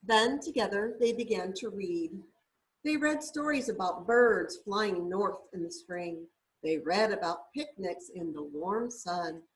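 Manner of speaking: gentle, lilting picture-book reading; storybook read-aloud voice; reading to children. Acted. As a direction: read it like a bedtime story for a little one.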